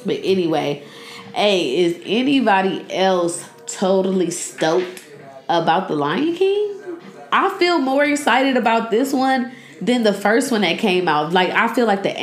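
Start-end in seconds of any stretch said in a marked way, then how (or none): none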